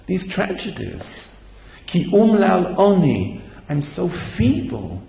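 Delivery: ordinary talk, nothing marked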